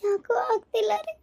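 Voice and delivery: pained, sobbing plea to her husband; tearful labour pain. Acted. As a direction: cry through the pain. (ನೋವಿನಿಂದ ಅಳುತ್ತಾ)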